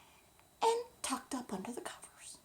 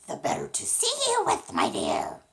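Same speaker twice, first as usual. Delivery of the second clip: wolf; 8 semitones higher, 11 dB louder than usual. Acted. Wolf answers Little Red.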